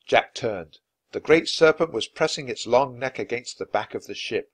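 ordinary delivery, nothing marked

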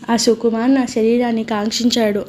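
plain reading voice

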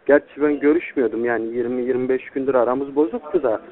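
ordinary talk, nothing marked

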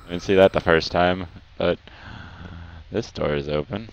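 ordinary talk

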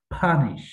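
punish with a strongly aspirated first p; teacher's demo of punish, "p-HA-nish"; puff of air. Shown as a demonstration. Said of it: "বাতাসে দাগ হবে একটা".

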